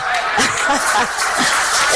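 breathy burst of laughter; a short unvoiced laugh. Real, not acted. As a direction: laugh out at the memory. Laughing at the gentleman story.

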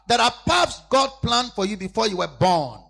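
loud, slow, high sermon cry; full-force preaching. Acted. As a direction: drive each word into the congregation.

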